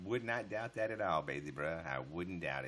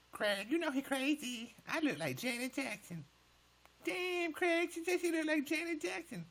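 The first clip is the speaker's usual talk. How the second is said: very high put-on female voice, teasing; mock woman's voice. Streamer mocking a viewer in a high girly voice. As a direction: squeal it way up like a sassy lady.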